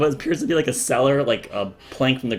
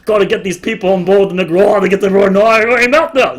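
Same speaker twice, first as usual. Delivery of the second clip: raised, loud son; 9 semitones higher, 11 dB louder than usual. Acted. Son responding to his father's nudge, loud. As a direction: chime in with dad.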